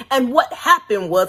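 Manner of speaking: normal talking voice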